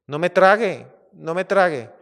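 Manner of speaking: acted desperate plea, high and loud; mouse pleading for its life. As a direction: beg loudly as a terrified little mouse. Acted mouse begging lion not to eat it.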